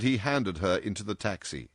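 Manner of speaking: plain reading voice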